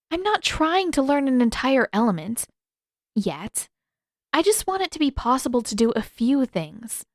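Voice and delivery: put-on stubborn young waterbender pleading her case; Katara, frustrated. Katara voiced.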